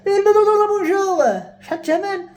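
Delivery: high, loud acted call that fades to normal level; mock phone caller. Caller asking a shop for the price, hammed-up high voice. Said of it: "تعيط شي واحد".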